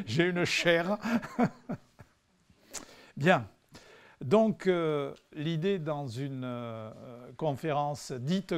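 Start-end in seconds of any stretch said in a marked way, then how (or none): 0.0-3.5 chuckling through the words; laughing. Real, not acted. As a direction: laugh while saying it, amused at his own joke. Joke about his academic chair. (en riant)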